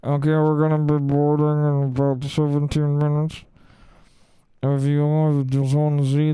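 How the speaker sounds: muffled monotone over a bad microphone; low, droning PA mumble that nobody can follow; unintelligible airport announcer. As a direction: drone out a mumbled gate announcement, words smeared together. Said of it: "She sounded like that".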